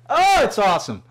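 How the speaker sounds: ordinary talk, nothing marked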